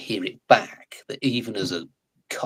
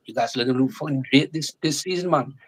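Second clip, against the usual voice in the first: higher-pitched, front-of-the-mouth Geordie; Geordie accent; 6 semitones higher, 3 dB louder than usual. Shown as a demonstration. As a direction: exuberant demonstration of Geordie speech. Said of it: "right at the front of the mouth like this".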